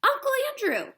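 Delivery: very high, loud shout of recognition. Acted. Diggory remembering his uncle at once, full shout. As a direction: yell the name as memory floods back. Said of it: "he shouted out".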